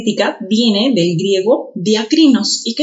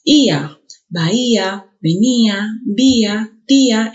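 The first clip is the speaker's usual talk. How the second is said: Ia, bahía, venía, vía, tía with a punched í; stress on each í; slower than usual. Shown as a demonstration. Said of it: "se puede pronunciar con mayor intensidad".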